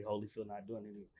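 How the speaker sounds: quick, low, hushed brush-off; imitated guard refusal. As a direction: refuse softly and fast in a deep register. Voices guard refusing once more.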